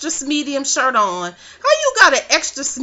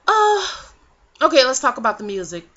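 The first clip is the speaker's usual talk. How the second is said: mock sudden switch. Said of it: "then she was like".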